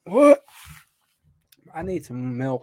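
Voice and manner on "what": high shouted what rising at the end; loud questioning what. Real, not acted. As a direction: yell it as a disbelieving question. Shouted reaction, echoed.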